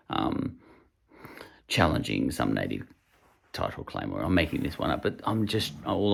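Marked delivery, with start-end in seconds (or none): none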